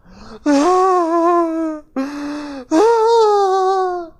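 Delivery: loud, high hammed-up wail; fake wailing gasp. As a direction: put on a big show of grief. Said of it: "they really put it on in a proper show".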